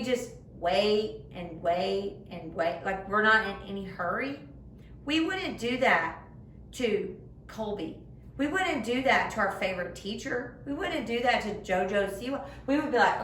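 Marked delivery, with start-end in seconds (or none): none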